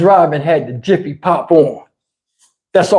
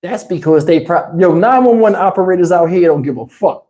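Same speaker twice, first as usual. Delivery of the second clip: usual speaking voice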